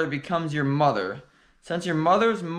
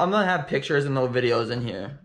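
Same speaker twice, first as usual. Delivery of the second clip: usual speaking voice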